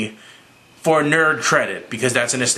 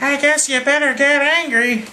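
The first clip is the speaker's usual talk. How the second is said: much higher, sarcastic dig; lightly voiced Captain America daring him; mock Captain America; 12 semitones higher than usual. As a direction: toss it off like a smug captain. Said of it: "And Captain America's like".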